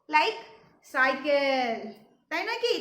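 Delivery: normal talking voice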